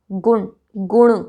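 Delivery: गुण first with dental n, then retroflex ण; न versus ण. Shown as a demonstration. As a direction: contrast "gun" and "guṇ" in गुण, slowly. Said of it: "जब हम गुण बोलेंगे". (गुण: पहले न, फिर ण)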